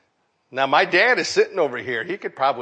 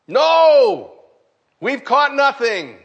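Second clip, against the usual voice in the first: shouted answer; 8 semitones higher, 6 dB louder, slower than usual. Pretend. Fishermen shout back to shore.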